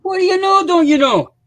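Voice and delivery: very high, loud Minnesotan caricature; squawky hammed-up Minnesotan; mock Minnesota accent. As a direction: push the Minnesota accent way over the top. Repeats mock Minnesota accent, bigger.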